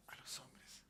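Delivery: low, whispered reply; very soft, almost voiceless; hushed answer. Real, not acted. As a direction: answer your own question under your breath. Hushed answer to own question.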